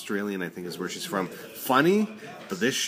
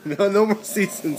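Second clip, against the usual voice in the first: laughing; 10 semitones higher, 4 dB louder than usual. Real, not acted. Laughing at his own answer.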